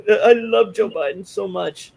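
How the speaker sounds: high, loud, drawn-out fake adoration; mock gushing. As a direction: gush over him like a lovesick fan, mocking.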